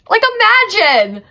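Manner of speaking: high, loud, drawn-out imagine; a slow, squealing imagine; incredulous. Real, not acted. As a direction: beg the listener to picture it. Excited retelling of shocking scene.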